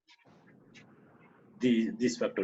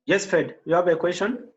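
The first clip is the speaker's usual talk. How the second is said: calling out; 11 semitones higher, 4 dB louder than usual. Real, not acted. Host calling out on bad line.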